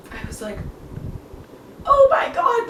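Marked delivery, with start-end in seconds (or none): 1.9-2.7 high, awed fangirl gasp; starstruck. Retold or imitated. Fan seeing an all-time idol walk in, starstruck. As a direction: breathe out an overwhelmed exclamation, pitched way up. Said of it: "I was so starstruck".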